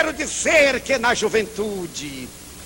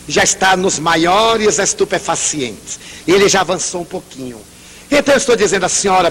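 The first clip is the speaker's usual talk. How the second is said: ordinary talk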